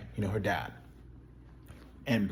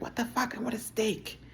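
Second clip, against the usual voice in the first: much higher, shrill mock outrage; mock outraged Claire; 12 semitones higher, 5 dB quieter than usual. Much higher mocking Claire snapping at a walk invite. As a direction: squeal it like an offended girlfriend. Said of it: "She'd be like".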